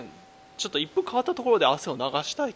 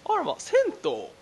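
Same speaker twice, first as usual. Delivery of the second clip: surprised rich lady. Pretend.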